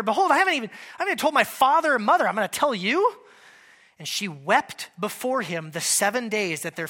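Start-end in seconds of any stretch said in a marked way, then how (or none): 0.0-3.2 louder, higher, fast exasperated protest; exasperated Samson. Acted. Samson giving in to his weeping wife, exasperated and fast. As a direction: give in to her nagging. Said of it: "he said to her".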